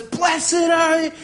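very high drawn-out mock scream; hammed-up excited female shriek; woman's scream. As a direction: screech it like an overexcited fan in a crowd. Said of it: "This woman screams out of the crowd".

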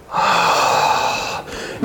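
long, weary exhale; drawn-out sigh before a rebuke; exasperated sigh. Acted. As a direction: let out a long fed-up sigh. Jesus fed up with his disciples.

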